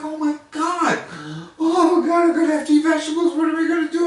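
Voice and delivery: high, loud, fast panic; mock horror at vegetables; panicked. Narrator panics over vegetables.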